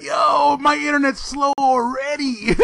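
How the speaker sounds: high-pitched shouted gripe, played for laughs; mock outraged complaint. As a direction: cry out in exaggerated protest. Mock outburst over internet speed.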